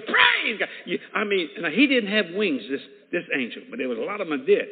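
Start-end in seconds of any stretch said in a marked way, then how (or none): 0.0-0.7 very high, loud shout of praise; imitating a piercingly high cry of worship; angel's praise cry. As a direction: echo the praise, pitched right up. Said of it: "He goes".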